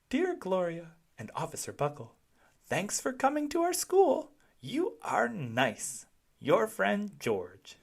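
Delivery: put-on high little-kid voice reading a letter; child's letter. A narrator's reading. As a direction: read it like a small kid proud of his note. Child's letter read aloud.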